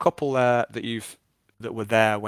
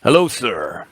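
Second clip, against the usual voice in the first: louder, hearty barman greeting; a lightly voiced innkeeper at his bar; 9 dB louder than usual. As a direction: greet the customer like a cheerful barkeep.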